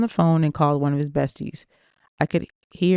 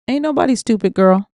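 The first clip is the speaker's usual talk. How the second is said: put-on higher, punchy scolding friend, louder on girl; sassy friend; 6 semitones higher, 5 dB louder than usual. Friend calling out the narrator, sassy scold. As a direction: call her out, sister-style.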